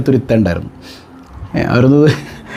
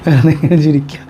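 laughing; 3 semitones lower than usual. Real, not acted. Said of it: "എനിക്ക് ചിരി വരുന്നത് വെറുതെ അല്ല".

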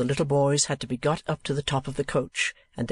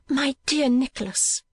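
put-on high, gentle young lady's voice; anxious sister; 12 semitones higher than usual. Sister drawing her brother aside, high anxious voice. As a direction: appeal to your brother in a high register.

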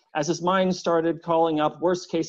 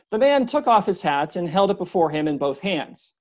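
ordinary delivery

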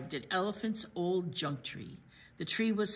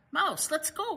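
a cheerful raised call; 7 semitones higher, 5 dB louder than usual. A narrator's reading. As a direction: call Mouse out of his house. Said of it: "called Bear".

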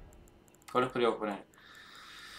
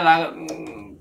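normal talking voice

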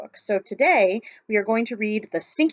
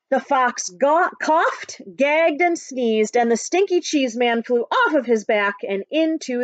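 put-on lively read-aloud for children; story narration; 3 dB louder than usual. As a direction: tell it like a picture book to a class. Teacher reads picture book aloud.

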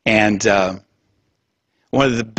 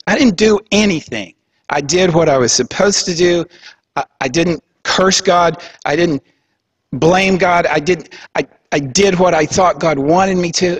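higher, pleading protest of innocence; protesting Job; 6 semitones higher than usual. Retold or imitated. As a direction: Job insisting he did nothing wrong, voice raised high. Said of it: "And Job's like".

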